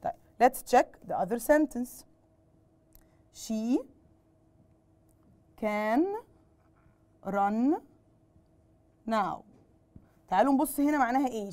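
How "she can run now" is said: each word alone, long pauses between; slow dictation. Shown as a demonstration. Teacher dictating to pupils, slow word-by-word delivery. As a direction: dictate it slowly for the class to copy. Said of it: "dictating the sentence word by word".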